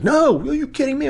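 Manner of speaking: high-pitched mock disbelief; worked-up, incredulous squawk; excited wingman. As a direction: imitate your animated buddy protesting. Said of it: "now he's all excited".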